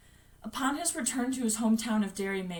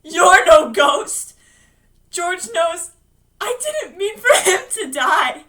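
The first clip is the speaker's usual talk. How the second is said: loud, high cry, near tears; anguished defiance; 6 semitones higher, 12 dB louder, slower than usual. Acted. Bill facing the false George, loud anguished defiance.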